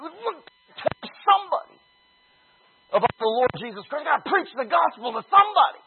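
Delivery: high, fast, excited preaching; fired-up zeal. Retold or imitated. Preacher reliving the night they hunted for converts, excited. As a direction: burst out with young believer zeal.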